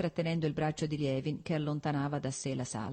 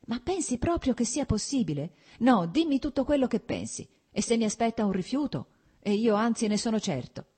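high, urgent, anxious questioning; agitated; 7 semitones higher, 4 dB louder than usual. A narrator's reading. Man in love begs his friend's opinion, agitated. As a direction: press for an answer, pitched up and insistent. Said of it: "sorridendo a quell'agitazione".

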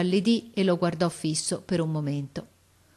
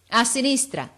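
raised, falling reply; calling up; 6 semitones higher, 4 dB louder than usual. A narrator's reading. Officer confirms to the lookout, calling. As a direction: answer firmly across the distance. Officer answers boy in tree.

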